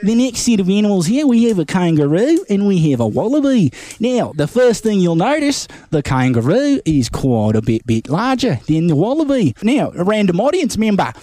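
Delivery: mock Aussie wildlife presenter; Australian accent. Robert Irwin explaining crocs on a talk show, light Aussie accent. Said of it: "Oh, good day, mate".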